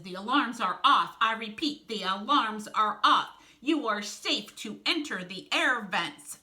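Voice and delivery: loud, emphatic all-clear announcement; Tarantula's official announcement. Acted. Tarantula tells the team the way is safe, announcing. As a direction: announce it loudly, slowing toward the end.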